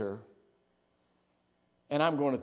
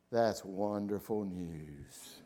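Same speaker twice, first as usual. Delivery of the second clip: deadpan, very slow, lower and softer; Victor; 6 semitones lower, 7 dB quieter, much slower than usual. Retold or imitated. Husband hears the shed will be rebuilt, discouraged slow deadpan. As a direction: imitate a discouraged husband's drawn-out reply. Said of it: "I wish I could say it like he says".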